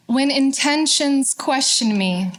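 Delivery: measured poetry reading with long pauses between lines; unhurried, deliberate verse, falling at line ends; poem recitation. Acted. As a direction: recite it line by line, letting each phrase settle.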